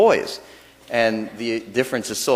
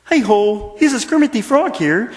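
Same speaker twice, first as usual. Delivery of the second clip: high, louder froggy puppet voice; Kermit the Frog; 9 semitones higher, 4 dB louder than usual. Pretend. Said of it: "maybe if Kermit the Frog did it".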